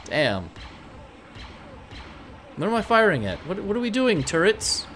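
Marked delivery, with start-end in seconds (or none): none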